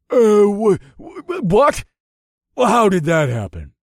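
high, loud, stammering outrage; stammer, then demand an explanation; stunned disbelief. A narrator's reading. Beaten opponent is stunned and furious.

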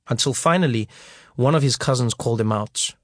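ordinary delivery

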